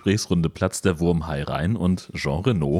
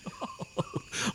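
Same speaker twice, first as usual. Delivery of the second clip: gehauchtes, tonloses Bösewichtlachen; verächtliches Lachen; 14 dB quieter than usual. Acted. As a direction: lacht leise und herablassend. (geflüstertes höhnisches Lachen)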